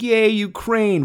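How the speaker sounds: slow, high, hollow mock cheer; sarcastic cheer. Ranter mocking America's empty support for Ukraine with a hollow cheer. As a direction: drag out a fake yay. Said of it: "we're gonna be like".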